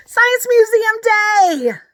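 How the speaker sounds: put-on high, loud, drawn-out girl's cheer; eager ten-year-old girl announcing museum day; excited little sister. A narrator's reading. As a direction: squeal it like an eager kid sister. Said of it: "she said".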